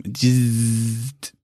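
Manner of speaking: long buzzing "zzzt"; drawn-out electric zap noise; imitated laser zap. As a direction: buzz like a laser firing. Imitates lasers hitting Trump.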